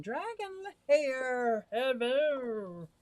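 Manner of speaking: high, slow cartoon pet voice; lightly voiced fish saying hello; voicing the fish. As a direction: speak for the fish Dragon.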